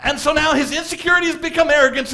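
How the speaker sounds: ordinary delivery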